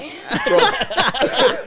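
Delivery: high-pitched laugh; real high laugh. Real, not acted. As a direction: crack up at the memory. Laughing at roast.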